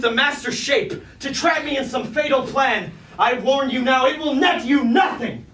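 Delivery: threatening warning. Acted. Starscream threatening the suspected impostor, loud and defiant. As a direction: warn the impostor off, rising in anger.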